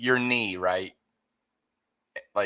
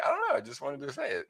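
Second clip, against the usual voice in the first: silly friend voice; 3 dB quieter, faster than usual. Retold or imitated. Voices friend's careless answer.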